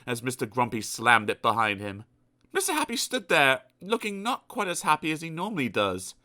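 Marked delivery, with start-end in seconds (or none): none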